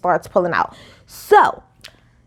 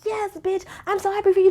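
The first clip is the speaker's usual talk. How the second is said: higher, sugary mock congratulation, softer; mock fake-sweet; 7 semitones higher, 5 dB quieter than usual. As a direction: play Crystal's fake happiness for her. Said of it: "Crystal was like".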